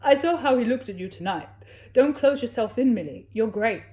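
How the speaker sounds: lightly put-on accent, earnest; Fiero's voice. A narrator's reading. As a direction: urge her gently not to hide. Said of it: "what accent Fiero is".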